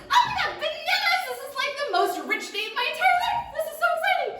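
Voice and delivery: high, loud, laughing squeals of joy; thrilled dragonfly. Acted. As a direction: burst with delight like a tiny bug. Dragonfly gets bananas.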